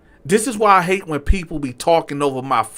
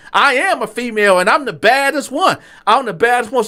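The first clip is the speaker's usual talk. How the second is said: higher, proud and loud; lightly voiced sassy waiter, chin up; sassy clapback; 8 semitones higher, 3 dB louder than usual. Waiter boasting back at the diner, high and proud.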